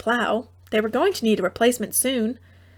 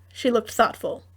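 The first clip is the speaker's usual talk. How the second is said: usual speaking voice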